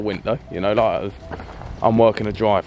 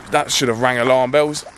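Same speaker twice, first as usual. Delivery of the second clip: normal talking voice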